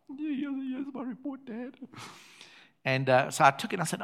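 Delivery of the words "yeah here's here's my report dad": high put-on voice of a sheepish teenage son; imitating his son, high and soft; son's voice. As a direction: squeak it out like a nervous boy handing over bad news. Son handing over report, voiced.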